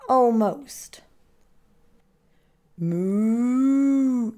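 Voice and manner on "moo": long, drawn-out moo; cow's moo. A narrator's reading. Cow interrupts Turkey in his horse costume, long drawn-out moo. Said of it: "said Cow".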